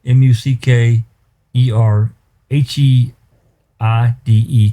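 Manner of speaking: slow, letter by letter; spelling out letters. Shown as a demonstration. Attorney spelling a case name for the judge, slow and deliberate. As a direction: spell the name out slowly for the court to the judge, one letter at a time.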